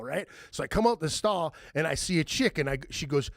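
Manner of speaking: usual speaking voice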